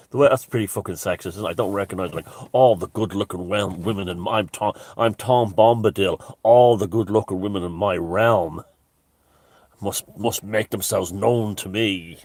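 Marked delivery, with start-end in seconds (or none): 1.5-12.2 pompous Tom Bombadil. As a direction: proclaim it like a pompous lord of the realm. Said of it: "Pompous fucker I am".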